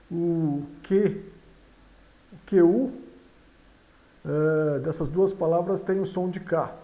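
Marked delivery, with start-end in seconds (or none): none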